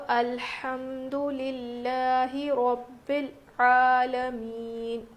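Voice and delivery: slow, drawn-out recitation voice; tajweed recitation. Shown as a demonstration. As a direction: recite it as a model for students. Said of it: "تو یہ پیش". (تلاوت کے انداز میں آہستہ پڑھنا)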